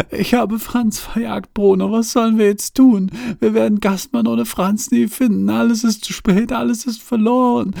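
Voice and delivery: put-on high, desperate young woman; high despairing woman. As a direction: cry it out, all is lost. Johanna despairing, character voice.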